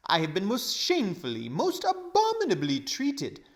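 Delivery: put-on high, loud, huffy old gentleman; aggrieved Uncle Andrew. A narrator's reading. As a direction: sputter out every grievance. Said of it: "temper at last got the better".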